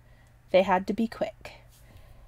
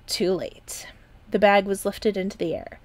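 ordinary delivery

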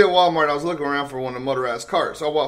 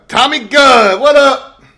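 loud, raised-pitch shout hello; hollered greeting; 9 semitones higher, 13 dB louder, slower than usual. Real, not acted. Streamer breaking off to hail Tommy Gunn, loud hollered greeting. Hailing someone who just arrived.

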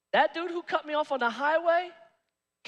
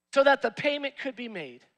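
normal talking voice

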